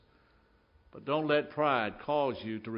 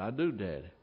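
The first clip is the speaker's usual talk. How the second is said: put-on a touch of a small boy, softer and lower; 5 semitones lower, 5 dB quieter than usual. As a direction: answer meekly like a nervous little son. Boy answers his father.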